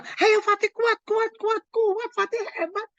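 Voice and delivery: high, pushy cheer of kuat, kuat; mock cheering on. Parent urging son Fatih to be strong, high mock cheer. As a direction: pump the boy up like a sideline coach. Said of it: "Terus bilang".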